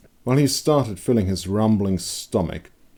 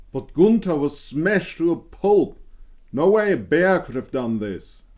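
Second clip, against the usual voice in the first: protesting woodcutter; 10 semitones higher than usual. A narrator's reading. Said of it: "one of the woodcutters protested".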